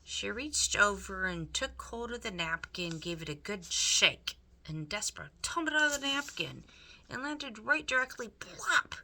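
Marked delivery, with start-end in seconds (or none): 4.6-8.9 excited narration. A narrator's reading.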